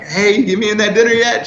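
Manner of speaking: high-pitched playful call-out; eager pestering. Retold or imitated. Man badgering a colleague about the secret dinner, eager pestering. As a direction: imitate an eager, cheery nudge. Pestering her whenever he sees her.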